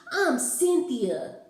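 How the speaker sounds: high, loud, challenging; snappy Cynthia. Acted. Cynthia challenges the kid, high and loud. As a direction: dare him to object. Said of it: "she snapped".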